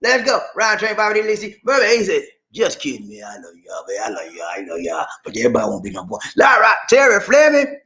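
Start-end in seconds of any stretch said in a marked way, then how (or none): 2.5-6.2 lower, softer voicing of a fan; a lightly voiced fan comment; quoted fan. 6.4-7.8 louder, higher call-out; an upbeat shout-out. Real, not acted. Calling out the next name.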